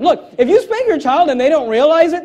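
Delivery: usual speaking voice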